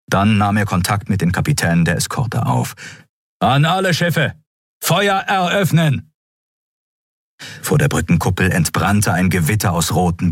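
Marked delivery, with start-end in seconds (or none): 3.4-6.1 high, slow barked order; command to fire. Acted. Admiral orders all ships to open fire, commanding. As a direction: call the fleet to open fire.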